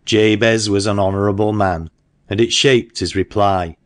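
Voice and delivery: ordinary delivery, nothing marked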